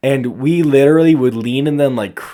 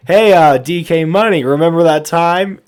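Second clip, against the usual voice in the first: louder, higher singsong ribbing; playful teasing call; 5 semitones higher, 5 dB louder than usual. Retold or imitated. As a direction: call out to him to tease him. Teasing the teacher about it.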